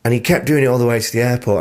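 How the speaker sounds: usual speaking voice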